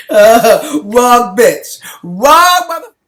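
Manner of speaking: high, loud, defiant yell; defiant shout. Real, not acted. Defiant outburst after the call.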